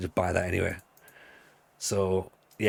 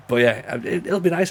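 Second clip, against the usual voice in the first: usual speaking voice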